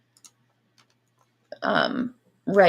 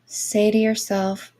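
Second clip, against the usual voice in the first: airy breathy mystical healer. Acted. Guided Reiki meditation.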